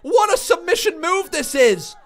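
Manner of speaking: high, loud wrestling call building to a shout; big-match commentator at full excitement; excited play-by-play. Real, not acted. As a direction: shout the submission as it locks in.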